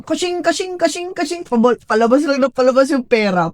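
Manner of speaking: high, loud ka-ching patter; animated mock alarm at draining cash; cash-register imitation. Mimics bank account money flying out.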